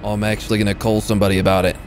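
mock-offended in a put-on Aussie-British accent; lightly hammed-up Brit-Australian accent; mixed Brit-Australian accent. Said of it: "new Brit-Australian accent".

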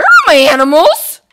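alarmed call for missing pets. Real, not acted. Finds animals gone.

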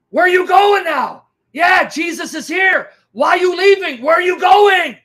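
high, very loud shouted questions; screaming at full volume. Retold or imitated. Woman screaming at a fleeing demon only she sees, imitated screaming. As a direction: shout it after someone running away, top of your lungs. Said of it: "She's screaming at the top of her lungs".

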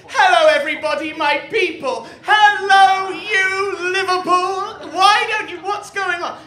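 high, booming over-the-top greeting to the crowd; mum greeting Liverpool. Acted. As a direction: hail your people at full, high-pitched volume. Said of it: "she comes back up here and goes".

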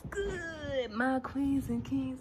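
high, slow, loud showman welcome; hyped greeting. Acted. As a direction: stretch every word like a big hype intro. Hyped vlog greeting to viewers.

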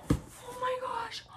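high, breathless whisper of disbelief; stunned whisper. Real, not acted. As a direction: whisper it, drawn out, in awe. Overwhelmed on opening the surprise box.

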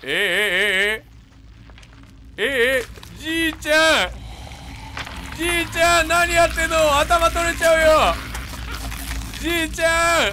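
high, loud disbelieving cries; shocked shouting. Real, not acted. Player shouting at the old man whose head falls, high loud shock. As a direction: yell out in real shock at the sight.

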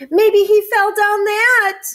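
high, loud storybook wail; wailing cat. A narrator's reading. Cat at the cliff fearing for duck, wailing. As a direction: cry it out high and distressed. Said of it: "wailed the cat".

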